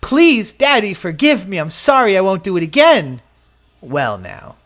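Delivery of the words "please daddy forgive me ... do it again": high, louder pleading, rushed at the end; begging child. Retold or imitated. Child begging his father to forgive him, pleading loud and high. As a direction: beg your daddy like a crying kid. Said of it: "you come crying, begging".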